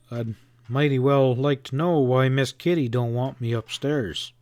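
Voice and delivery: normal talking voice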